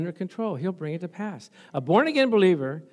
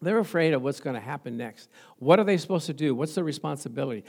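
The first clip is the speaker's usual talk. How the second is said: ordinary talk, nothing marked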